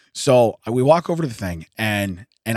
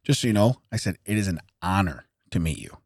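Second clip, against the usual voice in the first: low, soft, restrained tribute; understated confidential admiration; discreet aside; 6 semitones lower, 6 dB quieter than usual. Retold or imitated. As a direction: say it quietly, holding back the fandom. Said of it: "Like that, right?".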